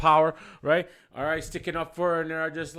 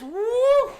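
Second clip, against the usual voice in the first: ducking whoop; 5 dB louder than usual. Retold or imitated. Dodging her swing.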